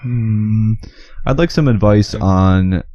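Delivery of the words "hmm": usual speaking voice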